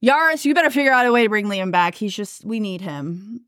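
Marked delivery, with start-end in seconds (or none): 1.9-3.4 much softer, wistful; softly pleading; quiet plea. Real, not acted. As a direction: say it softly, missing him. Sad plea about character.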